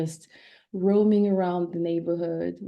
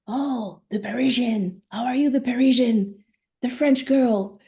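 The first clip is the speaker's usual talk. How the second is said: higher and louder, playful mock greeting; teasing uncle; 6 semitones higher, 3 dB louder than usual. Uncle greeting his niece back from France, teasing. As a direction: tease her like a fond uncle. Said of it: "this uncle who would call me".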